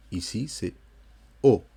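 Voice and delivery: ordinary talk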